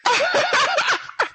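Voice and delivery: sudden high burst of laughter. Real, not acted. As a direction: crack up at the answer.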